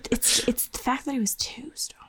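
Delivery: breathy whisper, no voice; whispered aside. Real, not acted. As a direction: whisper it under your breath.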